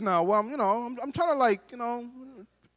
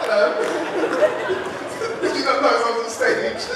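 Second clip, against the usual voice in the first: high, laughing hello to the crowd; laughing call-out; 5 semitones higher, 3 dB louder, much slower than usual. Real, not acted. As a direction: call out to two chatting people, amused. Calling out to chatting audience.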